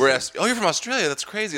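high, fast mock surprise; mock a stunned stranger; imitating a stranger. Stranger hearing the twins claim, fast mock surprise. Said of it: "they'll be like".

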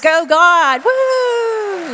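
high, loud joyful whoop; excited cheer. Real, not acted. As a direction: cheer it out with real delight. Celebrating the gift on stage.

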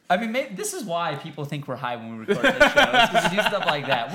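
talking through real laughter; laughing. Real, not acted. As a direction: explain it while cracking up. Cracking up over the silly bit.

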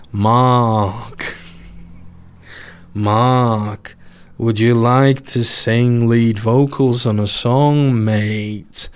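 drawn-out, slow put-on singer's voice; mock exasperation, stretching the words. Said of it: "how annoyed was [name]".

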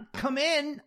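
high, strained croak; nervous croak. A narrator's reading. Jack calling the client in from his seat, nervous croak. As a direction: force out a strangled, high croak. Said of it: "I croaked".

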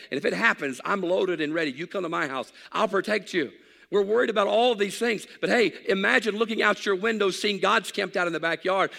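loud, high fervent preaching; preaching at full force. Acted. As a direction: preach it out, fired up and fast.

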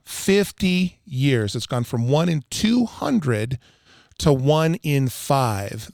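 slow, deliberate and weighty; slow emphasis. Real, not acted. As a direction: drive each phrase home, drawn out. Driving home an alarming statistic.

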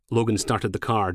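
usual speaking voice

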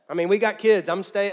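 put-on loud Southern accent at first, easing off; hammed-up Southern accent. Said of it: "I'm being redneck".

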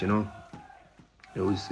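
ordinary talk

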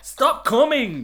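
high, loud, slow shouted order; mock yell. Mum shouting at the son, mock yell. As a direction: bellow it like a fed-up mum.

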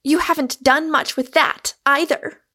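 high, loud scornful jab; angered. A narrator's reading. Angered Rosamond taunts Michael about his learning, scornfully. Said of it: "this angered her more".

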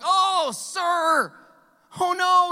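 high, loud panicked cry of loss; anguished cry. Acted. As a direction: cry out in sudden dismay. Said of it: "he cried".

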